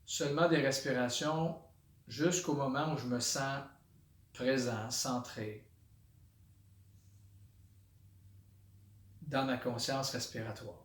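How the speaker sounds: soft, low meditation voice with long pauses; hushed, unhurried body-scan guidance; guided meditation. Shown as a demonstration. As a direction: settle into quiet, centred awareness, naming each sensation softly. Leads a body-scan meditation aloud.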